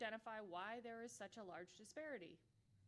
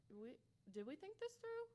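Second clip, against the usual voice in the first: mock self-doubt; 6 semitones higher than usual.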